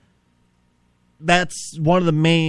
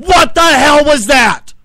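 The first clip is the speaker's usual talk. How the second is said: high, loud, slow angry demand; slow furious yell; 11 semitones higher, 11 dB louder, slower than usual. Acted. Host yelling at producer over the blunder. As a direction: spit each word out in disbelief.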